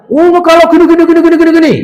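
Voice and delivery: high, loud rapid nagging; nagging wife. Retold or imitated. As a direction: rattle off the complaints.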